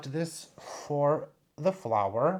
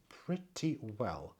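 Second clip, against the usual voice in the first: softer, slower repeat to herself; soft echo; 3 semitones lower, 6 dB quieter, slower than usual. Real, not acted. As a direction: let it fall away as a quiet aside. Echo of pretty well softer.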